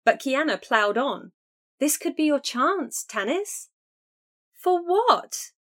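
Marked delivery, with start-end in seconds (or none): 1.8-5.5 higher, eager sister pleading; excited sister. A narrator's reading. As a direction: press her, pitch rising. Kiana urging, then exclaiming.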